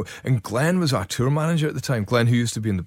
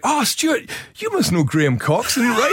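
high-pitched, chirpy re-enactment; mock eager introduction; 12 semitones higher than usual. Introducing Stuart to Graham, re-enacted eagerness.

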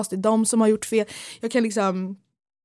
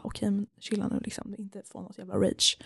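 calming herself; 8 dB quieter than usual. Retold or imitated. Telling herself to calm down.

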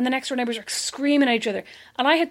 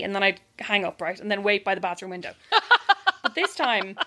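normal talking voice

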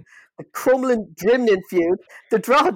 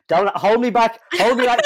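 hammed-up tough guy, a touch louder; mock fight bravado; 5 semitones higher, 4 dB louder than usual. [name] playing a hard man who wants to be held back, mock bravado. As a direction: pretend to strain at an invisible leash.

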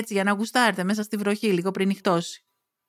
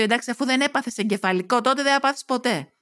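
usual speaking voice

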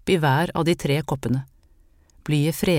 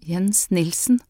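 frightened mother, voice a bit shaky; trembling voice; 3 semitones higher, slower than usual. A narrator's reading. Mother answers the healer, voice a little trembling. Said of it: "sa moren med skjelvende stemme".

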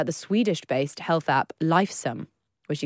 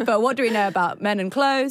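ordinary talk, nothing marked

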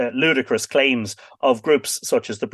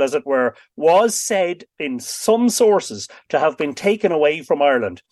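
normal talking voice

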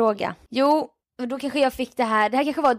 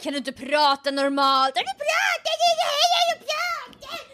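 high, shrill mock scream of an angry caller; slower than usual. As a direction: yell it at the host like an outraged listener. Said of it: "Hon skriker". (hånfullt härmat argt skrik)

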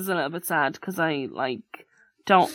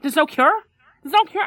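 high, loud incredulous question; mock outrage at the news; imitating shocked dad; disbelieving; 8 semitones higher, 4 dB louder than usual. Father disbelieving.

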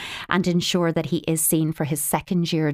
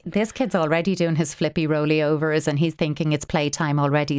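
ordinary delivery

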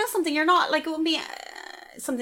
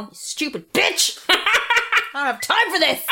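higher mock-outraged insult; mock angry outburst; 7 semitones higher than usual. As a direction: play at snapping at a patient, laughing between. Acting out slapping a patient.

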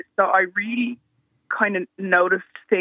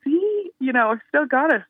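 higher, gloating playfully; smug triumph; 8 semitones higher than usual. Retold or imitated. Back home and people laughing again, higher and gleefully smug. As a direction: crow that the jokes still land. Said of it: "and I was like".

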